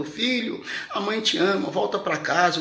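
high, loud tearful mother pleading; a mother begging her son to come home; mother's tearful plea. Retold or imitated. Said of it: "entrou chorando".

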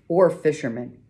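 ordinary delivery, nothing marked

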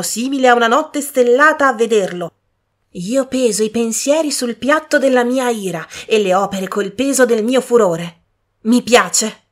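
carried away by the poetry; enthusiastic. A narrator's reading. Young woman enthusing to her sister over the verse, loud and rapt.

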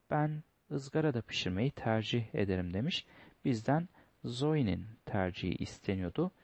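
normal talking voice

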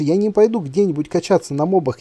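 usual speaking voice

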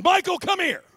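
high, loud summons; mock angry dad shout; stern dad calling. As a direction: bellow it like a father calling. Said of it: "If my dad would have come in".

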